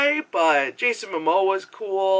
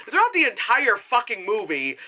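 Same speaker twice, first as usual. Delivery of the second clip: ordinary talk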